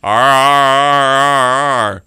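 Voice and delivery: loud growling Wookiee roar; Chewbacca roar. Retold or imitated. Pastor imitating Chewbacca's roar at hyperspace, loud growling roar. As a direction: roar it out like Chewie. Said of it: "when Chewbacca goes".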